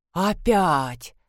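drawn-out mild moan; groaning. A narrator's reading. Old woman as the light flickers, groaning. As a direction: groan the word wearily. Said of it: "простонала старушка".

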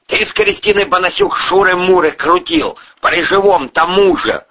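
high, loud angry charge; accusing. Acted. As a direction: throw the affair in the culprit's face. Boss presses his accusation.

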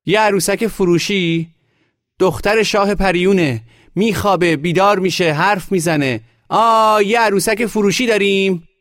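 very high, loud hawker's cry; brazen street shouting. A narrator's reading. Boy carries doll on shoulders, shouting to sell it. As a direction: shout your wares down the street.

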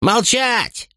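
high barked order to silence; barked command. A narrator's reading. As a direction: snap the troops silent. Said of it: "рявкнул старший трибун".

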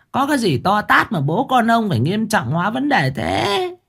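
put-on high, sarcastic old wife voice; snide jab. A narrator's reading. Wife mocks husband's worry, high sarcastic voice. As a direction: needle him with scorn, pitched high. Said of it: "bà lại cạnh khóe".

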